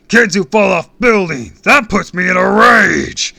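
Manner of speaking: huge, high roar of anger; booming rage. A narrator's reading. Supervillain scolding a kid who fell, roaring. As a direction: bellow it, stretching the last words. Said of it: "His voice was so loud and deep".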